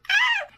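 short high squeal of excitement; excited squeal. Real, not acted. Too excited about cookie ingredients, squealing. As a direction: let out a thrilled squeak. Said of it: "I'm too excited".

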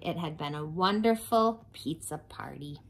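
ordinary delivery

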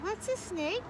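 put-on high little child's question; curious young frog. A narrator's reading. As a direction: ask high as a small frog. Frog children ask Father.

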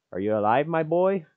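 lightly put-on fatherly voice; voiced game character. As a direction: play the stranger checking on him. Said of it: "I gotta get a voice for him".

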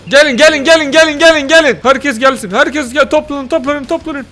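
high, loud repeated summons; rallying shout. Pretend. Player calling everyone to gather round, rallying shout. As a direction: call the whole crowd over urgently.

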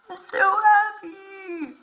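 high, slow, stretched joy; drawn-out happy squeal. Real, not acted. Finds village, repeats happy.

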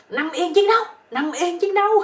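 high, loud mock battle order; yelling. Old army teacher to his bedmate, a hammed-up combat yell. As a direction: yell it like a military command, hammed-up. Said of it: "ổng la lên".

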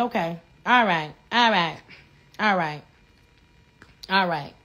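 low, dismissive all rights; low flat sarcasm, letting it sink in; sarcastic low okays. Real, not acted. Sarcastic agreement after the rant.

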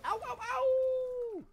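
high, slow, stretched howl; wolf howl. Pretend. Wolf pack howling call.

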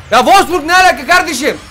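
high, incredulous outburst; outraged disbelief. Real, not acted. Gamer baffled by a Wolfsburg player, outraged disbelief.

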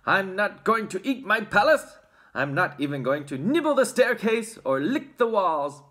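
loud, high, defiant prince; shouting prince. A narrator's reading. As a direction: declare it loudly in protest. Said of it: "shouted the prince".

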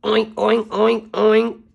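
high, loud oinking pig noises; pig oinks. Acted. Pigsty pigs grunting at the runaway egg, oinking.

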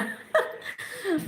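high, bursting laugh; burst of laughter. Real, not acted. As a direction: let a sudden high laugh break out. Laughs at own joke about attendance.

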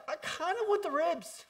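high, loud hesitant whine; whiny mock friend. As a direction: play a reluctant buddy craving ribs. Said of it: "I'd be like".

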